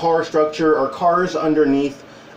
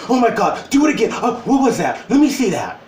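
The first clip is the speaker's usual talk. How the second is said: put-on fascinated kids; 6 semitones higher than usual. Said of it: "And they said".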